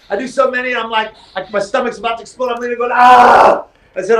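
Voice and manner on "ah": loud straining yell mid sit-up; strained yell. Retold or imitated. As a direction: belt out the effort cry. Straining through sit-ups.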